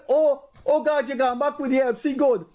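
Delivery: a high, excited mock cry; imitated delighted outburst; excited exclamation. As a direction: exclaim high, thrilled he is back. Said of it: "And he said".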